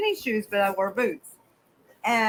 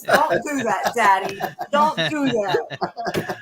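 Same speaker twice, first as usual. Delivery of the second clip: dog voice; 4 dB louder, much slower than usual. Retold or imitated. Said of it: "He says".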